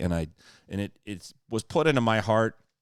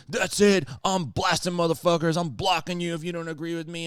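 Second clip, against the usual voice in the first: higher, heated mock rant; a lightly voiced online hothead; mock angry blocker; 8 semitones higher than usual. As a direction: play the hothead who blocks everyone. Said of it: "I'm not like".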